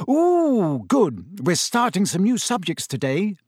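high, delighted "ooh" into eager chatter; happy. A narrator's reading. Hermione examining her new schedule, happily. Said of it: "she said happily".

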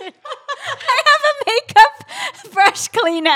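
high, slow, laughing through it. Real, not acted. Host cracking up over the guest's makeup brushes. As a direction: squeal it while cracking up.

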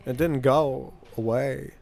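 slow, halting celebrity impression; deliberate, stop-start film star voice; Christopher Walken. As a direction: stretch the words with odd pauses. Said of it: "when I discovered Christopher Walken".

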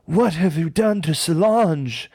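mild mock trailer voice. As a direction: mimic a movie trailer narrator. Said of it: "and it goes".

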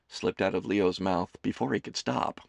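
ordinary talk, nothing marked

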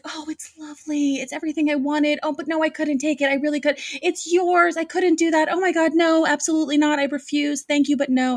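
high, quick flustered voice, loving it but saying no; gushing refusal. Retold or imitated. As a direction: torn between wanting it and being polite.

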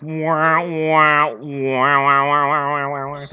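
droning wah wah, fading away; mock the dull line with a horn; sad trombone imitation. Shown as a demonstration. Mocking the actor's lines, sad trombone wahs fading. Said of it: "Sad trombone".

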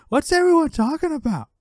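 high-pitched mock dad yell. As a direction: holler it like a nosy dad butting in.

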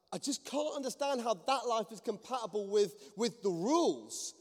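high, exasperated, slowing on the last words; worked-up protest. Retold or imitated. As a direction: imitate someone building to an exasperated point. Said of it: "getting worked up".

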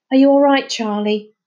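concerned call. Acted. Concerned call after fall.

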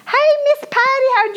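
high, sugary come-on voice; mock charming online suitor; sweet-talking scammer. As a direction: lure her in with a syrupy greeting.

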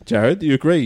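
ordinary talk

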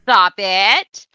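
loud scolding stop; mock parent scolding a child, loud stop; mock stern shout. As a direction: snap it like a fed-up parent. Acting out John Cena scolding.

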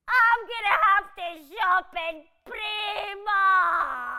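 slow, drawn-out tearful wail; hammed-up sobbing despair; melodramatic wailing. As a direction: wail it like the world is ending. Melodramatic despair over money.

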